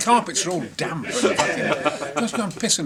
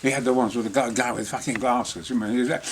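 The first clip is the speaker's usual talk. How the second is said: usual speaking voice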